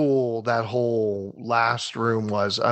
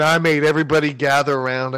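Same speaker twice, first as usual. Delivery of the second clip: normal talking voice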